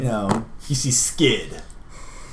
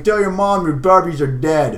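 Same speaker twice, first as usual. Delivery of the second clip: louder laughing retort; goofy brotherly jab; 6 semitones higher, 5 dB louder than usual. Acted. Skid mocking his sister, with a laugh. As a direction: toss it back with a laugh.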